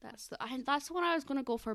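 ordinary talk

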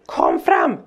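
mild mock yell; retold yelling. Mum ordering hidden children out, retold yelling. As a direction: imitate an order shouted across a park. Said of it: "så här högt".

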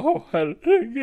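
high, drawn-out fake sob; hammed-up tearful despair; mock crying. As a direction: wail it as if collapsing in tears. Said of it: "Och gråter".

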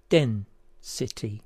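"Den, city" slowly, split in two; syllable by syllable. Shown as a demonstration. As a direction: break "Den, city" into its separate sounds. Word split into puzzle syllables.

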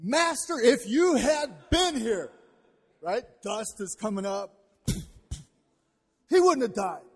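Martha's loud heartbroken reproach; anguished grief-stricken outcry. Shown as a demonstration. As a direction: hurl it at him in raw grief. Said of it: "And it's more like this".

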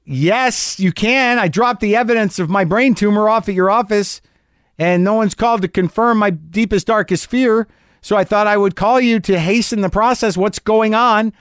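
high, pushy, sarcastic demand; imagined snippy demand. Pretend. Patient to receptionist, demanding results, high and sarcastic. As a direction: lay it on the receptionist with fake politeness. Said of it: "I didn't say that".

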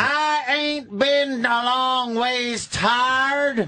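mock Southern drawl. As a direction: drag out a fake Southern accent. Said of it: "that accent".

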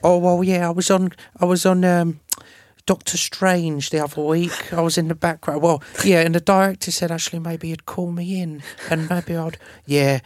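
lightly voiced bragging film extra; a hint of a boastful background actor name-dropping; mocking extras. Mimicking extras bragging to friends, mild mock boast. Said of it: "I was just listening to them going".

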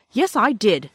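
loud, stung retort; angry. A narrator's reading. Brother defends himself to his sister, loud and angry. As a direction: snap back, hurt and angry. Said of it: "said Peter angrily".

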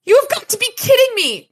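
high, loud, rapid yell; screamed disbelief. A narrator's reading. Murphy to Dresden as they race at a car, screaming. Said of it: "Murphy screamed".

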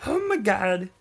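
high, loud mock "oh my god"; mock excited gasp. Podcaster imitating his own hyped reaction, mock excited. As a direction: blurt it like a thrilled fan, hammed-up. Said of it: "I didn't go like".

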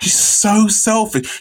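high, slow sarcastic complaint; slow, high sarcastic whine; mock indignant. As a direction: drag it out in fake outrage. Mock outrage at girlfriend.

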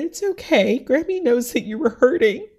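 high, choked-up reassurance; fighting tears while imitating gentle reassurance; tearful retold comforting. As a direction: quote a loved one's comfort through tears. Quotes dying grandmother while tearful.

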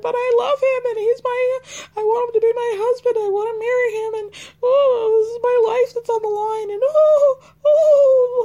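hammed-up crying, begging through tears; over-the-top imitation of a sobbing plea; Jenny crying. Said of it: "my Jenny crying impression".

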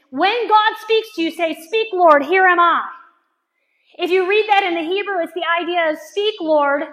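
high, loud, driving preacher; impassioned preaching. Real, not acted. Preacher urging the room to obey God, impassioned. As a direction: preach it at full force.

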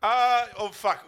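normal talking voice

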